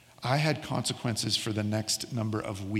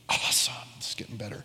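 whispered dry aside; sarcastic whisper. Real, not acted. Pastor telling his own story, sarcastic whispered aside. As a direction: breathe it out sarcastically under your breath.

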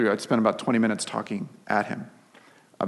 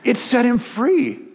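higher, louder, slow proclamation; triumphant; 13 semitones higher, 7 dB louder, slower than usual. Acted. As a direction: proclaim it with joy. Preacher's triumphant punchline.

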